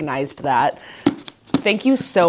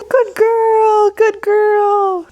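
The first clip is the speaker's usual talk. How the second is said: much higher and louder, praising the dog; pet praise voice; 6 dB louder, much slower than usual. Real, not acted. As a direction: squeal praise at a good dog.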